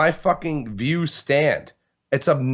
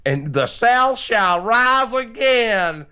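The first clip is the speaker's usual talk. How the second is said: mock Southern rallying cry; 8 semitones higher, 3 dB louder, much slower than usual. Mocking Confederate flag wavers.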